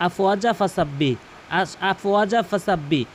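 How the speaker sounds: আফওয়াজা ফাসাব্বি run together unstretched; wrong short vowels. Shown as a demonstration. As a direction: read আফওয়াজা straight on without holding. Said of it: "এটা কিন্তু ভুল".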